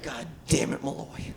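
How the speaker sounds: breathy, exasperated low mutter; an exasperated chief scolds his rogue cop. Retold or imitated. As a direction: sag against the door, fed up. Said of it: "just exasperated, like".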